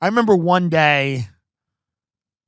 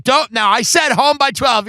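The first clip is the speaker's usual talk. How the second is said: higher-pitched mock scolding mom; a hint of a mother laying down curfew; lightly voiced nagging mom; 7 semitones higher than usual. Mom reminding son of curfew, lightly voiced nagging mom. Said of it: "she'd be like".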